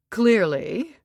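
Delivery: ordinary delivery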